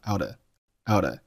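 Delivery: "out of" shortened to "outta"; reduced "out of". Shown as a demonstration. Teacher demonstrating "out of" reduced to "outta". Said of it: "out of的缩读".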